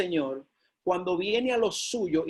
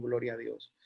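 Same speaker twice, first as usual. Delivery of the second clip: much lower and softer; murmured aside; 8 semitones lower, 8 dB quieter than usual. Real, not acted. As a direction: drop the refrain to a low murmur. Refrain repeated, dropped to murmur.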